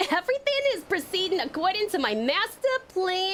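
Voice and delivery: playful high re-read of the line. Acted.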